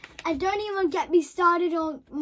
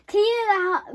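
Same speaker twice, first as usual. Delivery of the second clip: plain reading voice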